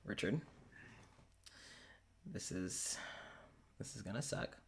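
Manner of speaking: very quiet, halting and low; hushed warning. Acted. Claudius warns Richard before he can comment, hushed. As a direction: break it to them softly, with long pauses.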